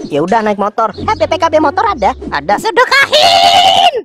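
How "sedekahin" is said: very slow, high drawn-out command; slow, high sermon-style urging; preachy cow. Acted. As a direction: stretch it out like a preacher.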